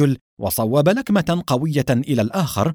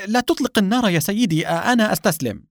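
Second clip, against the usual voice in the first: high-pitched frightened surrender; scared, high pleading voice; fearful; 8 semitones higher than usual. A narrator's reading. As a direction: plead for your life, pitched well up. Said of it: "قائلًا بخوف".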